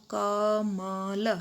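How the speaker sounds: कमल said very slowly, letter by letter; slow, spelled out. Shown as a demonstration. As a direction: drag कमल out slowly, sound by sound. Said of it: "तो तीनों के एक साथ बोलेंगे". (कमल को धीरे धीरे अक्षर अलग करके बोलना)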